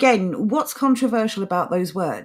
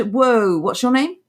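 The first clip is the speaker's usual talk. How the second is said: usual speaking voice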